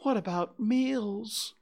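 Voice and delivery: high, slow, plaintive question; pitiful high bear voice; gloomy bear. A narrator's reading. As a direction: ask forlornly, drawing it out. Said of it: "Pooh gloomily".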